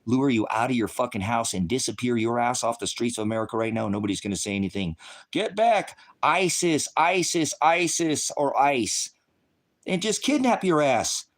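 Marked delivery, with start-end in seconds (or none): 5.3-11.2 mock agent shout. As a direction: play the agents yelling as they grab someone. Voicing masked agents grabbing people.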